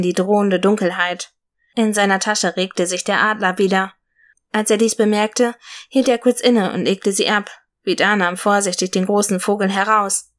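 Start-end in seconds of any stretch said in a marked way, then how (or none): none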